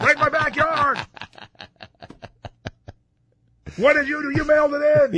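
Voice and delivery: loud, high mocking yell; mocking shout. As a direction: taunt the jumper loudly.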